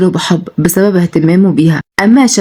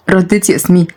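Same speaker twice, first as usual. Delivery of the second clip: usual speaking voice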